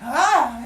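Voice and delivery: high, loud yelp; mock cry of pain; imitated startled cry. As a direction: yelp as if kicked from inside. His leg kicked from inside.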